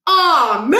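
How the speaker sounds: a kid groaning at a bad photo; dismayed groan. A narrator's reading. Stuart's dismayed exclamation.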